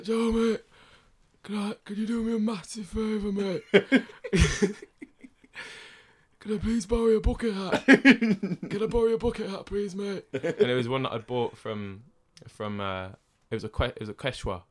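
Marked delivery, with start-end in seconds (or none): 0.0-10.2 higher pleading mate voice, laughing in places; imitating friend pleading. Friend begging to borrow the hat, mock pleading. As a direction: beg for the bucket hat in a put-on voice. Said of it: "He's like".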